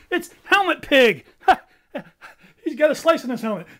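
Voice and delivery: high laughing delight. Acted. Bird laughing loudly at Helmet Pig. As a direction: giggle through the words.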